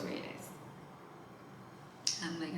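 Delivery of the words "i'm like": normal talking voice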